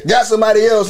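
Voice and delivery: ordinary talk, nothing marked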